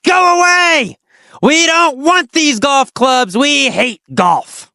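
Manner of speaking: high, protesting shout as a crowd; mock angry. Poor tribes reject Tony's golf gifts, angry mock shout. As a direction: shout them off, drawing out the words. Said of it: "they got very, very angry".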